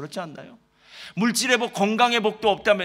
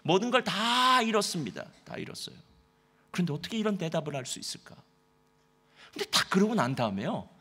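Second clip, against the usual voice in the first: ordinary delivery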